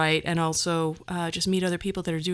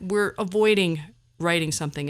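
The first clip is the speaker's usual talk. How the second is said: ordinary delivery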